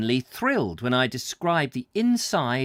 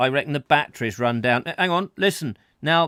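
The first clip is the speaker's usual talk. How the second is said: ordinary delivery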